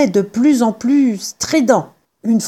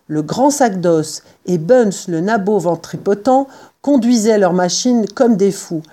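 ordinary delivery, nothing marked

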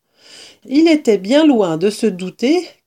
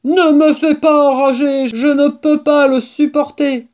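loud, high, exasperated protest; worked-up, raised badger voice; yelling; 7 semitones higher, 5 dB louder than usual. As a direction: shout that you can't bear it. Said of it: "hurla Blaireau".

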